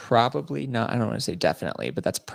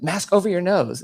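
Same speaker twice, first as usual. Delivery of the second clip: raised, high mock command; imitated TSA order; 11 semitones higher, 6 dB louder than usual. TSA lady to passenger pretending not to understand, mock exasperated order. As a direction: send up an official losing patience.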